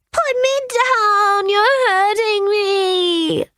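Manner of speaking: put-on high, slow crying girl; crying child. A narrator's reading. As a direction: wail it through tears. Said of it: "Sarah screamed".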